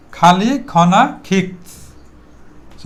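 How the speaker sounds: very slow খালি খনা খিক্স, no R, K puffed; drawn-out খালি, খনা, খিক্স with silent R. Shown as a demonstration. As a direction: stretch খালি খনা খিক্স word by word with aspirated K. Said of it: "silent থাকবে".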